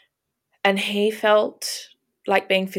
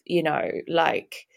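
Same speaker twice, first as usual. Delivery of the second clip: ordinary talk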